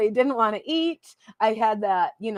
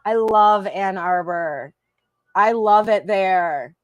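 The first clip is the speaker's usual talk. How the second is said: drawn-out fond; much slower than usual. Real, not acted.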